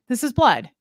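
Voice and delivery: flat certain statement, light Nicolas Cage; a hint of Nicolas Cage, stating "blood" firmly with a falling tune. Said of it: "I feel like Nicolas Cage".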